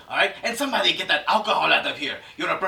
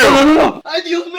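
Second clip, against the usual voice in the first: high loud alarmed cry; panicked shout; 11 semitones higher, 11 dB louder than usual. Acted. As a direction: panic as the fight breaks out. Director panics, Spanish.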